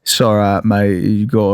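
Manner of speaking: low British accent; loose, low British rogue voice. Shown as a demonstration. Demonstrating their fallback accent for co-hosts, British accent. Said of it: "Jack Sparrow slash [name]".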